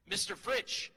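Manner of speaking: ordinary talk, nothing marked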